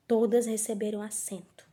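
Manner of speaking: hushed clue. Real, not acted. Teacher confiding a detail to pupils, hushed. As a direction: say it under your breath like a secret.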